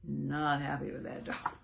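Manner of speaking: usual speaking voice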